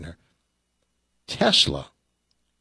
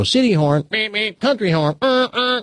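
higher-pitched mock advert demonstrating two horns; imitated commercial horns; 10 semitones higher than usual. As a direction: mimic the car horns, beeps pitched high. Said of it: "in the T V commercials, they'd go".

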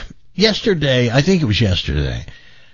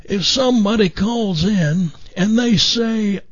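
put-on higher raspy voice with a little southern Missouri accent; Jim McMillan impression; 8 semitones higher, slower than usual. Jim praising Frank's impression, in raspy southern voice. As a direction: chuckle through it like a friendly garden-show host. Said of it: "kind of a raspy voice, a little southern accent".

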